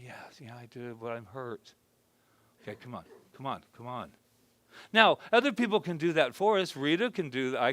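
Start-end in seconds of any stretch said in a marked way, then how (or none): 0.0-4.1 soft, hushed talk with himself; gentle inner dialogue. Shown as a demonstration. Speaker demonstrating self-talk with his hurt self, quiet and tender. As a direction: coax the truth out kindly.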